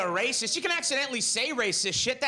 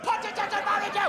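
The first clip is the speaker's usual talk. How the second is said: high, booming title shout; mock show announcer; 11 semitones higher than usual. Announcer shouting the show's title every episode. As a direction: announce it like a TV intro.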